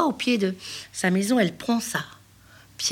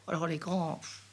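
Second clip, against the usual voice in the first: plain reading voice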